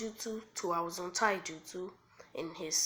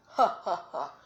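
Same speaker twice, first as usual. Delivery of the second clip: loud, staged "ha, ha, ha"; punchy acted villain laugh; mocking laugh; 8 dB louder than usual. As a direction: laugh it out as a scornful beast. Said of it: "he starts laughing".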